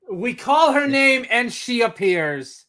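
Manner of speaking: excited. Real, not acted. Excited as she joins chat.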